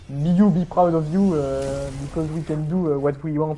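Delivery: hammed-up French-accented English, loud start, hesitant; slow, halting English with a thick French accent; François Hollande English. Streamer mocking French speakers' English, hammed-up French accent. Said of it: "à la François Hollande".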